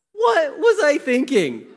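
high, loud, slow disbelief; hammed-up dismay, stretching out the question; incredulous self-reproach. Preacher voicing anyone who looks back with regret, incredulous.

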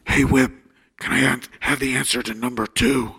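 a hint of the loud rasping whisper again; half-voiced raspy calling. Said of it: "All raspy like that".

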